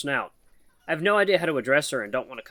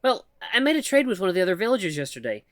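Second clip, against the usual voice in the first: higher, halting nervous voice of a shy mouse; timid mouse woman; 9 semitones higher, 3 dB louder than usual. Acted. Shy mouse woman nervously calls to the human, halting and high. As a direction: stammer it out like a timid mouse calling for attention.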